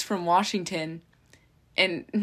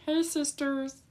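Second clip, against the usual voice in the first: imitating his catchphrase, higher; chirpy YouTuber greeting; James Charles greeting; 7 semitones higher, 4 dB quieter than usual.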